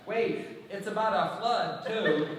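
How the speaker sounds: calling after them down the aisle. Retold or imitated. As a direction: shout after a departing couple. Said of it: "I call after them".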